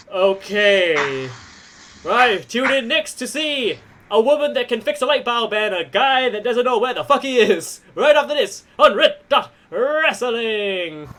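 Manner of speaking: hyped ring announcer sign-off; wrestling promo. Acted. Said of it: "The announcer's like".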